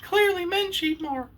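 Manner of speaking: sneering falsetto imitation of a woman, laughing; mocking a woman. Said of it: "And she's gonna say".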